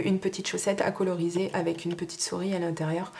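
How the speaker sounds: normal talking voice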